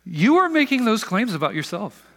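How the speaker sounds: loud, high mock accusation; mean accusing tone. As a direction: throw it at them, mean and loud. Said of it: "Just with that tone".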